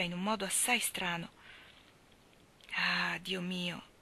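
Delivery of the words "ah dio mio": very slow, soft drawn-out cry of dismay; slow stricken sigh of Dio mio; anguished exclamation. Acted. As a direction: let the ah stretch out in quiet despair. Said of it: "esclamò Landry".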